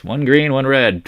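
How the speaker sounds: usual speaking voice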